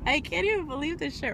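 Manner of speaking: high, laughing, overcome with joy; giddy excitement. Real, not acted. Telling viewers about a subscriber, giddy and laughing. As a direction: squeal it out, can't find words. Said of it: "full of excitement and emotion".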